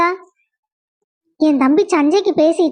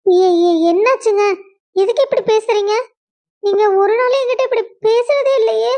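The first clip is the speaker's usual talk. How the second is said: higher, shaken pleading with her husband; begging him to explain, voice pitched up in hurt; distressed pleading; 4 semitones higher than usual. Acted. Wife begging her angry husband why he talks so, distressed and higher.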